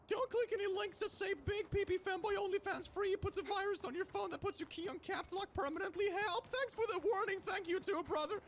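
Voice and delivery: loud, high yelling of every word; all-caps shouting. Pretend. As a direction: read it like caps lock is stuck. Caps lock stuck permanently.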